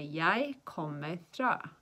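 very slow, deliberate words; slow teaching model. Shown as a demonstration. Teacher showing how to answer where you're from, slow demonstration. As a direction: measure out each word for learners.

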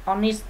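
"honest" beginning with an o, h silent; no h at the start of "honest"; starts on o sound. Shown as a demonstration. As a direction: say "honest" straight from the vowel o. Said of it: "đọc từ này bắt đầu bằng âm o".

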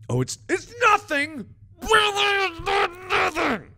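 high-pitched, frantic stammering denial; flustered denial. Acted. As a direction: blurt it out high, in a hammed-up panic. Flustered character denies having a secret.

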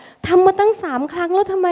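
imitating a parent fed up after three tries; impatient parent. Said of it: "แล้วก็บอกว่า".

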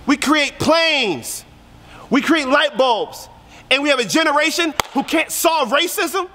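mounting pulpit fervor; preaching climax. Real, not acted. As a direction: climb in pitch as the outrage grows.

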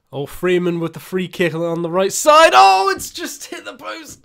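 excited commentary. Real, not acted. Gamer calls a free kick hitting the post, excited shouting. Live commentary on a near miss.

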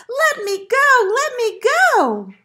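high, loud, stretched piglet squeal. A narrator's reading. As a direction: squeal to be set free. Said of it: "The piglet kicked and squealed".

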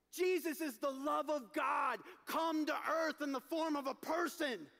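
usual speaking voice

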